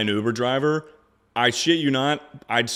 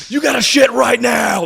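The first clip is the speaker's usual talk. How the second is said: high, loud urgent yell; 13 semitones higher, 10 dB louder than usual. Retold or imitated. Streamer voicing his sudden urge, loud high yell. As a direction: shout it like a sudden alarm. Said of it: "It's always just like".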